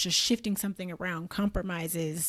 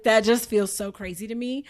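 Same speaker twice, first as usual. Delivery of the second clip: ordinary talk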